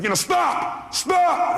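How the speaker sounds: mild retold yell on the radio. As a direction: cut her off, mock yelling. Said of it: "I started yelling on the phone".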